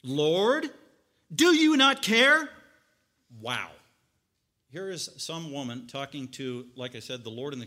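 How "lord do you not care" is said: loud, slow, high accusing question; accusing cry. Retold or imitated. Martha accusing Jesus, loud and slow. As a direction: fling the question at him.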